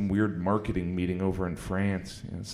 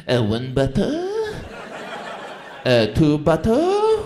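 mock French guy; 7 semitones higher, 6 dB louder, slower than usual. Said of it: "some guy over in France next to a stream going".

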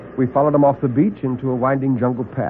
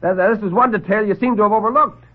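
triumphant; 10 semitones higher than usual. Acted. Pulls his gun on Grove.

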